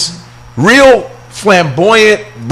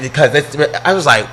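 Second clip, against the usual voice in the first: normal talking voice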